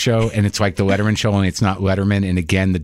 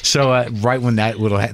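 ordinary talk

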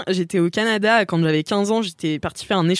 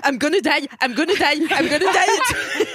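rising mock panic, higher on each repeat, ending in a laugh; 7 semitones higher, slower than usual. Retelling her panic to a friend on the ride, mock fear that climbs. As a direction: climb from worry to a laughing wail. Retold panic on roller coaster.

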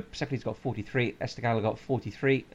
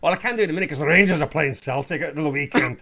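Scottish; 4 semitones higher, 5 dB louder, faster than usual. Retold or imitated. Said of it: "your Scottish impression".

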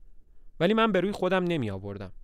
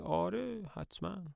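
sarcastic sure, higher and much softer; mocking; 5 semitones higher, 8 dB quieter than usual. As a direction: scoff at her hope. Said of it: "با مسخره میگه". (با تمسخر و کنایه)

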